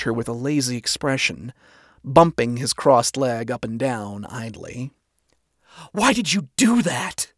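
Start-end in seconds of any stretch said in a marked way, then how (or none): 5.9-7.3 indignant confrontation. A narrator's reading. Charlie confronts him after slamming table.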